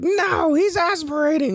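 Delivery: very high, insistent mock screech; hammed-up old woman contradicting; alarmed old lady. Mocking the old lady correcting him, high insistent screech. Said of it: "this fucking old lady".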